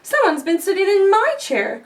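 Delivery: a touch of shocked mama bear; cried. Acted. Mama Bear finds her chair used, mild cry. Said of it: "cried Mama Bear".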